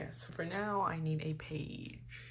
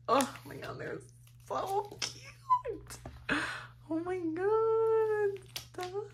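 high squealing fangirl voice; much slower than usual. Real, not acted. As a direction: squeal it with delight. Fangirling over the group.

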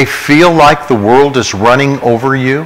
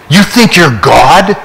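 higher, louder, slow sneer; a scornful retold jab, higher and louder; angry mocking challenge; 5 semitones higher, 4 dB louder, slower than usual. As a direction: throw the accusation back at him. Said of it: "people so angry at me".

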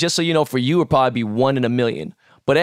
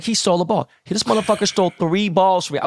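indignant outburst; 7 semitones higher than usual. Retold or imitated. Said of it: "And I was like".

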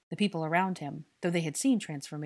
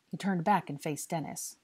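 plain reading voice

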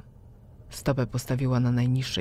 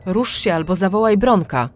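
raised irritated voice; 5 semitones higher, 7 dB louder than usual. A narrator's reading. Irritated trapped mother demands help from daughter, loudly. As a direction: snap at her to help, voice raised. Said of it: "zwróciła się do mnie podniesionym głosem".

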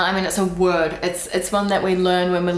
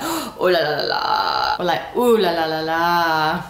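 drawn-out oh la la; 2 semitones lower than usual. Shown as a demonstration. Demonstrating a dismayed oh la la. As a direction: stretch it with dismay. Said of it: "So if you're like".

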